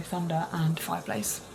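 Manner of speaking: ordinary delivery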